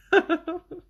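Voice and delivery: high, loud laugh at herself; burst of laughter. Real, not acted. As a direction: laugh out at your own joke. Laughs at sounding old.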